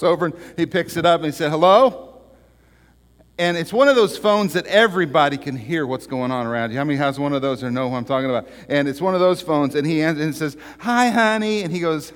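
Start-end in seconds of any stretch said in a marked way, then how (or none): 1.5-2.2 loud, high questioning hello; acted phone greeting, high and loud; answering the phone. Joke character answers the phone. 10.8-11.6 high, loud wifely greeting; acted wife's voice, pitched up; wife on phone.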